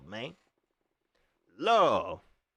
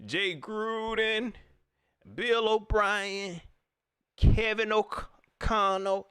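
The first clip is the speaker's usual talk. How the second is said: slow name-dropping; 6 semitones higher, much slower than usual. Acted. Acted Rhule listing names to Tepper.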